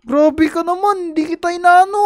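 normal talking voice

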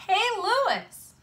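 high-pitched hailing call; shouted call. A narrator's reading. Kid hailing a friend across the playground, high shout. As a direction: call out to get his attention. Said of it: "Damon shouted".

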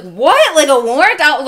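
loud, high-pitched shocked outburst; retold freak-out. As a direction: blurt it back in loud disbelief. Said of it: "I flipped my shit".

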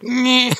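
high squeaky nasal-free deflating squeal; deflating balloon noise. Retold or imitated. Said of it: "And it's like".

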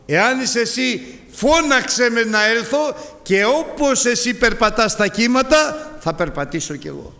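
high, loud, pleading cry; Peter's bold plea. Retold or imitated. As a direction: call out boldly across the waves. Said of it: "Απ' τους δώδεκα ένας πήγε". (έντονη, ψηλή ικεσία του Πέτρου)

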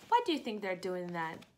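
plain reading voice

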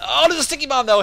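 loud, high startled yell; surprised shout. Real, not acted. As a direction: jolt at the sudden bomb. Sudden bomb in live cast.

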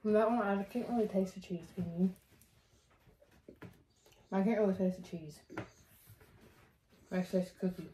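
speaking with food in mouth; words around a mouthful; talking while chewing. Real, not acted. Seen 0: Both eat cookie pieces; unclear who speaks, chewing seen throughout.

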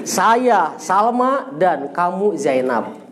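each word spaced out slowly for the student; very slow dictation. Shown as a demonstration. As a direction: spell the sentence out at half speed.

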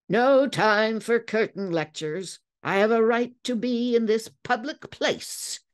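high, sly and taunting; a mocking, wicked retort; wickedly. A narrator's reading. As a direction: needle him with a wicked grin. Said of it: "he said wickedly".

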